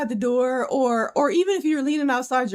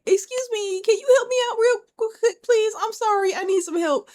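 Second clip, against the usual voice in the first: higher, sweet damsel-in-distress ask; polite plea for help; 10 semitones higher than usual. Retold or imitated. Girl at the gas station asking a stranger for help, higher and sweet. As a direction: play the helpless girl asking nicely. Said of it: "stand at the door ... and be like".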